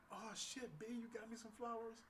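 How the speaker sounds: put-on playful take on her New York accent; New York imitation. Date thanking him for flowers, in a put-on New York accent. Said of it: "she was so New York".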